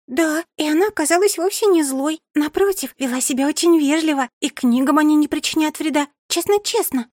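put-on eager high-pitched young girl. Young girl pleading earnestly.

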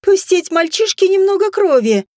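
shrill it out in falsetto. A narrator's reading. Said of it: "Опять фальцет".

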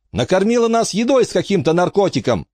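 high, urgent accusation; shouted protest. A narrator's reading. Bronwyn accusing Frankie, high cry. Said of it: "крикнула Бронвин".

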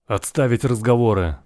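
sharp, loud command to the crew; barking order. Acted. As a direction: bark it like a captain. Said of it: "гаркнул Мик".

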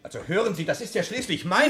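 loud flustered objection cut off; outraged protest. Acted. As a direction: push back against the threat. Protesting at gunpoint.

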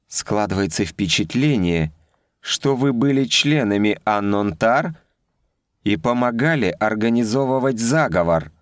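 freeze them with icy sarcasm. A narrator's reading. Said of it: "а ледяной голос произнёс".

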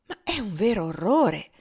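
horrified cry; shocked exclamation. Acted. Miss Ophelia, shocked by her cousin, exclaims. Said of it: "esclamò Miss Ophelia".

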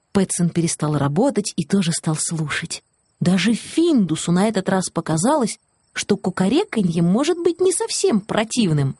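ordinary delivery

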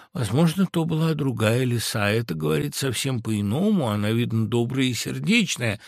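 ordinary delivery

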